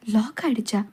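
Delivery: ordinary talk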